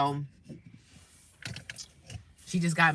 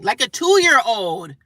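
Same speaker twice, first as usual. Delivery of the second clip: loud, higher, drawn-out disbelief; exasperated emphasis; 5 semitones higher, 10 dB louder, much slower than usual. Real, not acted. Venting about a screaming caller, exasperated and loud. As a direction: stretch it out, loud and incredulous.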